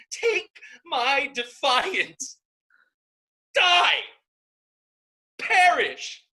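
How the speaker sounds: very high, very loud, spat out; a sister's shrieking curse, slow then explosive; screamed curse. Acted. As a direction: hurl each word at him like a stone. Isabel curses her brother.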